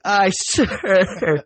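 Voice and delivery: high strained recruit reply; high, strained recruit answer; strained aye sir. Retold or imitated. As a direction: squeeze it out mid-plank.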